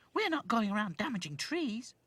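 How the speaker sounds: high doll voice; protesting doll. Acted. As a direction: insist innocently, pitched high. Doll objects to giraffe.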